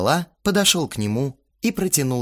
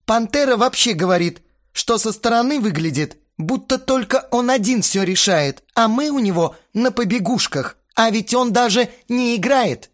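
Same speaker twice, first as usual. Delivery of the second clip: raised, high indignant voice; a worked-up bandmate complaining; heated complaint; 13 semitones higher, 4 dB louder than usual. Acted. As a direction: protest hotly, pitched up. Astarot's angry complaint.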